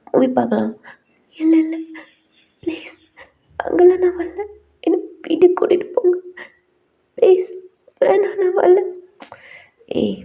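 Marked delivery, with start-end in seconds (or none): 1.4-8.8 high, teary refusal, half whispered; frightened pleading. Acted. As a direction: plead not to go, voice rising in panic. Wife refuses going there.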